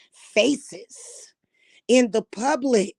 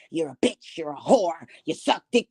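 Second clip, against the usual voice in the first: ordinary talk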